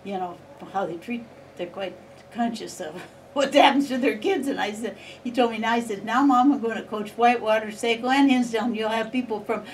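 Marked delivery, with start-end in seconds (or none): 6.0-9.6 retold warning, louder, breaking into laughter; laughing while quoting son. Mother retelling her son's coaching warning, laughing loudly. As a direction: quote her son's teasing warning through rising laughter.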